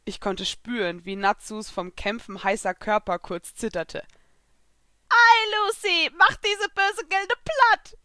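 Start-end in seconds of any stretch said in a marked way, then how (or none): 5.1-8.0 high, loud, slow little-cat voice; excited high-pitched creature cheer; Happy. A narrator's reading. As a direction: cheer her on in a squeaky cat voice. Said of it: "antwortete Happy".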